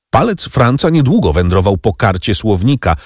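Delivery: ordinary delivery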